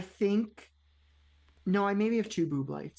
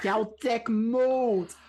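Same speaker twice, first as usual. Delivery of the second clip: echoed New York accent impression, loud and stretched; drawn-out, hammed-up New York accent; 2 semitones higher, 5 dB louder, much slower than usual. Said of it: "[name] also did a New York accent".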